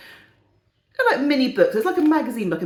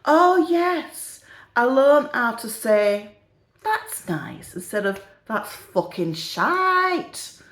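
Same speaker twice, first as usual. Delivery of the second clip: slow, high, put-on refined English accent; old lady showing off her new refined accent; mock-refined accent, every word drawn out; elocution-lesson accent; 4 semitones higher, much slower than usual. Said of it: "her accent's changing a bit".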